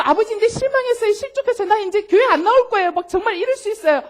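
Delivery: high, loud mock sulk at the Father; sulky complaint. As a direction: pout like a hurt child threatening to quit. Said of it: "하고 그 다음날 또 와서".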